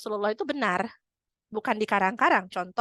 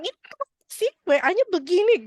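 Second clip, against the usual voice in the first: high, louder annoyed complaint; irritated quote; 10 semitones higher, 4 dB louder than usual. Retold or imitated. Wife reading a WhatsApp while irritated, annoyed. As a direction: snap at a message while upset. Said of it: "kalau dalam kondisi lagi kesel".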